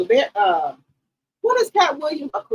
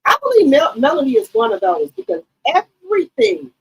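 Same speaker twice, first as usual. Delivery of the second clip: usual speaking voice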